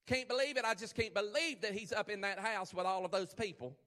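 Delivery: mock indignant onlooker; gossiping Pharisee. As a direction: play a scandalized Pharisee grumbling to a friend. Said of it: "I don't know what kind of accent that is".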